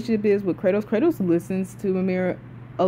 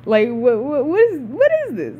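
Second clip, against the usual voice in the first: mock-bewildered stammer rising high; bewildered reaction; 6 semitones higher, 3 dB louder than usual. Streamer replaying her reaction to Kratos's confusion, bewildered. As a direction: act out baffled disbelief, tripping on the first word.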